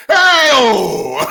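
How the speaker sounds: sudden high roaring crowd cheer; a wrestler imitating the crowd's roar, very high and loud; crowd pop shout. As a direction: belt it out like a whole arena popping. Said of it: "Literally, it was like".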